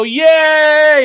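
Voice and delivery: high, loud cheering crowd; mock crowd cheer. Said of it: "a bunch of people go".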